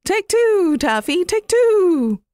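a daft dove repeating its call; silly dove cry. Acted. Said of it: "the turtle dove kept on saying".

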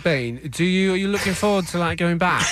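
put-on jittery, fussy British accent; neurotic British voice. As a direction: ask it as a nervous Brit. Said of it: "It's a neurotic British voice".

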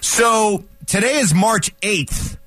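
high, slow teasing host build-up; dramatic radio tease. Acted. Host teasing listeners about the date, slow and high. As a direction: draw out each question like a countdown.

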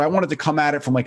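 ordinary talk, nothing marked

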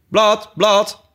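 high, loud double sheep cry; sheep calling again. Acted. Knitting sheep calls out to Alice, high and insistent. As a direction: bleat it out twice, impatient. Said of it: "riep het schaap weer".

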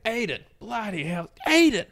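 high-pitched, over-the-top marvelling; Adrian, crazy energy. Retold or imitated. Crazed customer marvelling at cafe guy's name, crazy energy. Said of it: "same kind of crazy energy".

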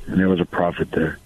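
ordinary talk, nothing marked

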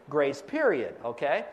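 ordinary delivery

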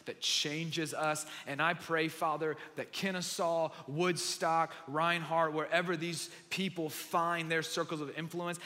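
earnest, measured pastor's prayer; closing prayer. Acted. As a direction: pray over the congregation, steady and sincere. Closing prayer over the room.

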